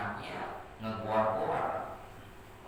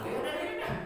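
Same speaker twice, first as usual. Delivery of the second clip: very high, quick yes-yes; eager agreement; much faster than usual. Acted. Ali grabbing the buyer's offer, high and eager. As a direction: jump at the offer in a high squeal. Seller accepts double price instantly.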